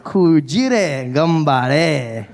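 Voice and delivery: very slow drawn-out Kujire gambare; a very slow hammed-up Japanese phrase; slow mock Japanese. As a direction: stretch Kujire gambare out solemnly. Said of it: "I went".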